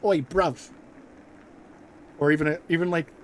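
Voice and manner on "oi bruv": put-on louder Cockney street accent. A guy from London greeting a mate, in a Cockney accent. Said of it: "a guy going".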